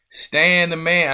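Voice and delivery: loud, slow hype call; big slow shout-out; hyping up. Real, not acted. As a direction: stretch it out and shout out the guest. Hyping up his guest.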